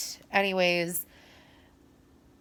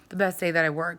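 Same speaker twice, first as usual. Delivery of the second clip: usual speaking voice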